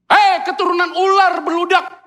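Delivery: loud, high firm rebuke of vipers. Retold or imitated. Preacher voicing Jesus rebuking Pharisees, loud firm rebuke. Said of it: "Yesus ngomong itu bukan kasar".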